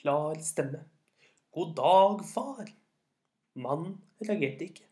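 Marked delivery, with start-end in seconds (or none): 1.5-2.6 loud, clearly projected greeting; raised, ringing storyteller greeting. A narrator's reading. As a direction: project it clearly to a very old man. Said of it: "med en høy, klar stemme".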